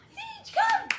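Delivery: high loud come; loud high excited recall; shouted recall. Shown as a demonstration. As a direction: call her in full volume. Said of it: "as soon as I say come".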